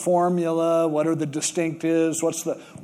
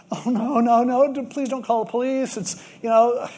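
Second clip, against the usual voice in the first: flustered pleading; 6 semitones higher than usual. Retold or imitated. Panicked apology to homeowner.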